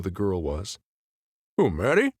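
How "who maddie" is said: talking through a mouthful of donut; words round a bite of food, mouth full. Acted. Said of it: "I'm talking with my mouth full".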